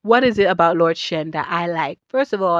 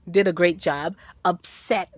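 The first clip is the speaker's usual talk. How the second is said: ordinary talk, nothing marked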